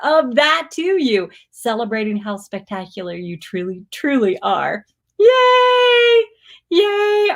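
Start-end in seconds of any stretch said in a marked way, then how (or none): 5.2-7.3 high, loud joyful shout; cheering yay. Real, not acted. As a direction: cheer it out like a fan. Cheering a viewer's win.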